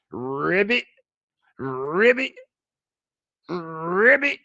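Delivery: taunting ribbits, one after another; string of mocking frog croaks. Shown as a demonstration. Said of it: "Froggy ass motherfuckers".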